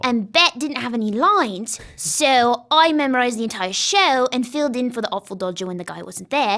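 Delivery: put-on British stage accent for the Artful Dodger. Shown as a demonstration. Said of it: "I was showing you the accent".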